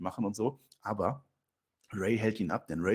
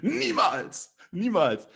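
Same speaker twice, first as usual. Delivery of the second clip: Dominik refusing; 6 semitones higher, 4 dB louder, much slower than usual. Acted. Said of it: "Und Dominik".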